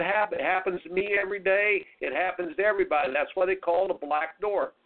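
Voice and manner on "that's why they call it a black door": ordinary talk, nothing marked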